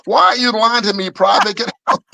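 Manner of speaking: high barking drill sergeant yell. Retold or imitated. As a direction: bark it like an outraged drill instructor. Drill sergeant catches the lie.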